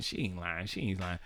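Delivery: muttered aside. Retold or imitated. Him siding with the cousin quietly, muttered aside. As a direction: mutter it under your breath.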